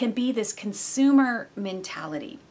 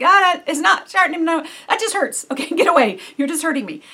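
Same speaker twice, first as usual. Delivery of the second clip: higher, louder, laughing mock complaint; mock pained protest; 8 semitones higher, 10 dB louder than usual. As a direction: whine it away half-laughing. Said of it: "you're like".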